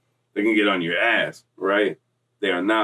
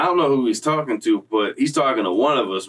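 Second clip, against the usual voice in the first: ordinary talk, nothing marked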